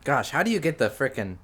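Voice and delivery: usual speaking voice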